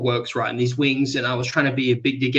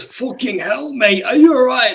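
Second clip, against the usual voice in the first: mock British accent, pitched well up; 9 semitones higher, 4 dB louder than usual. British stranger in the next cubicle checking on him, mock British accent. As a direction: imitate a startled British bloke, high-pitched. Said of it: "this British accent".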